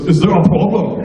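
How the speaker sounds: mock Austrian-accented challenge. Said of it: "never quite lost his Austrian accent".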